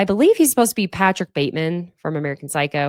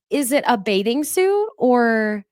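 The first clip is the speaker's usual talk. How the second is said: snide mock question; 5 semitones higher, slower than usual. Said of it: "Danielle immediately goes".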